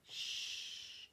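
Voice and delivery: unvoiced shh for a silent letter; shushing sound. Shown as a demonstration. Teacher showing the silent E with a shush. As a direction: hush like the silent E. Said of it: "is silent".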